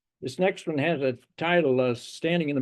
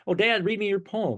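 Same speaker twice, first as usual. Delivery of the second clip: put-on higher-pitched little boy asking dad; six-year-old son; 7 semitones higher than usual. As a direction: lightly mimic a young child's eager request. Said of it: "six years old at the time".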